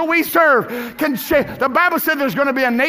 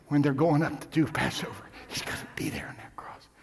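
hushed whisper; 10 semitones lower, 11 dB quieter than usual. Real, not acted. Preacher falling to a whisper about the cross. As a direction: drop to a breathy whisper.

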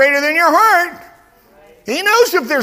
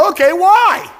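louder, higher challenge; defiant retort; 7 semitones higher, 6 dB louder than usual. Retold or imitated. Believer answering Satan, loud and challenging. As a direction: fire back at the accuser.

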